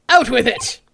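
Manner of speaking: lightly voiced gruff old attorney; gruff old lawyer. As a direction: push him to speak up. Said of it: "what should his voice be?".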